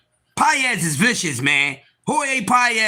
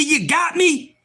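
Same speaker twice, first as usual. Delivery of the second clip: ordinary talk, nothing marked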